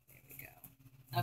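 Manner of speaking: soft slow whisper. Real, not acted. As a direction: breathe it out in a whisper. Quiet moment while crafting.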